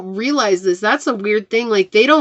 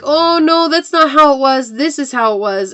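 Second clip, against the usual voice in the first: higher, louder mock denial; defensive denial; 6 semitones higher, 4 dB louder than usual. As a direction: brush off getting caught, a touch indignant. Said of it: "they're gonna be like".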